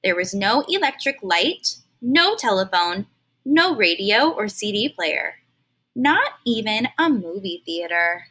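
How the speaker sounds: plain reading voice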